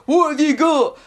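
a hint of an intimidating recycling worker; scary man. Scary recycling worker challenges someone at the front of the queue, mock intimidating. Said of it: "the scary man that works there".

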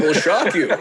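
clickbait video narrator. Pretend. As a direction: hype it like a teaser. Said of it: "clickbait title".

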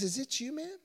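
soft, tender question; gentle Jesus. Acted. Jesus gently asking the trembling woman, soft. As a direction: ask her kindly and softly. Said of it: "He says".

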